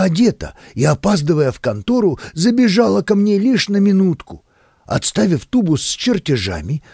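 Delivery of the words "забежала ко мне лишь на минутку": usual speaking voice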